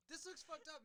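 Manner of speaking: high-pitched mock dismay; mock customer complaint. Customer objects to the old barber's cut, mock dismay.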